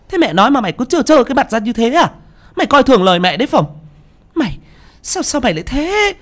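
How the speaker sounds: high, fast furious scolding; a mother screaming at her son, high and fast. Acted. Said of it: "càng thêm điên tiết".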